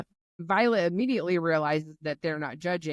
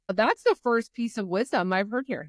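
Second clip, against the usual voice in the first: normal talking voice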